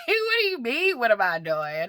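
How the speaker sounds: high-pitched, mock-incredulous comeback; indignant retort. Mother answering daughter who caught her crying, indignant retort. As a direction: throw the question back, voice shooting up.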